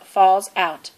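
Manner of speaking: ordinary delivery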